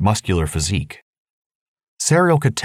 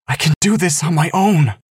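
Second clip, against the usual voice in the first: almost yelling; 10 semitones higher than usual. Acted. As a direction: rise to an angry half-yell. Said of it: "Angelita's voice rose, almost yelling".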